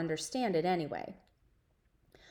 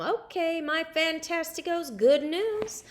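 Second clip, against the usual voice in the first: higher, cheery teacher announcement; 7 semitones higher than usual. A narrator's reading. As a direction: address the class with cheer. Teacher addressing class.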